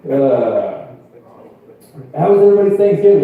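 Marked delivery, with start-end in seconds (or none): 2.2-3.2 fast, loud cheery host voice. Acted. Comic rushed loud question.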